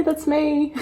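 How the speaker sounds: ordinary delivery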